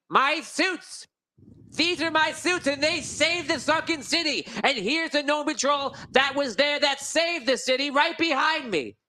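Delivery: high, slow, self-important claim; pompous lord. Acted. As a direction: stake your claim grandly. Wheaterton claims his suits.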